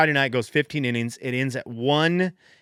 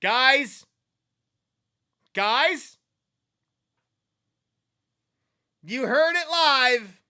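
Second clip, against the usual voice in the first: high, breathless call to the audience; real excitement; 10 semitones higher, much slower than usual. Real, not acted. Host yelling to listeners over a home run, excited. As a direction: shout it out, thrilled it happened live.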